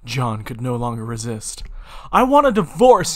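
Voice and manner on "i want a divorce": loud, high scream; a yelled demand, pitch up. Acted. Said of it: "he screamed".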